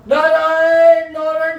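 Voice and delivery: long, high, drawn-out call; acted crying out. Sick dying father calls his son for water, crying out. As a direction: cry the name out slowly, high and held. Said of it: "चिल्ला रहा है".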